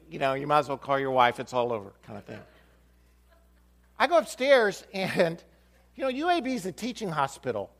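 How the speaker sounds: ordinary talk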